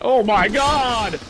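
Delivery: loud, high, drawn-out outburst; exasperated yell. Real, not acted. Gamer at an annoying enemy, exasperated loud yell.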